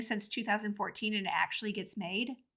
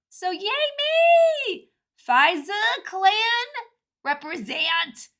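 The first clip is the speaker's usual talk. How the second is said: mock hype; 9 semitones higher, 6 dB louder, slower than usual.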